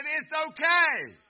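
loud, high, slow shout of faith. Retold or imitated. Donna tells the preacher it's okay, a loud shout. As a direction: ring it out like a joyful shout. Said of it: "she shouted and said".

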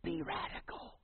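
whispered coaxing like an angel on her shoulder; whispered urging. Retold or imitated. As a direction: breathe it in her ear. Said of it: "trying to be this little angel on her".

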